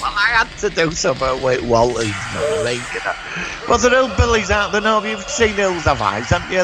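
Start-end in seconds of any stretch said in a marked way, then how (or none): 3.7-6.6 high, insistent point; emphatic. Real, not acted. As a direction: press the point playfully. Joking about hillbillies.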